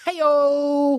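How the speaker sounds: high, drawn-out "hey-o!"; mock-triumphant high holler; punchline cheer. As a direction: whoop it like a comedy sidekick after a gag. Rimshot-style cheer after the punchline.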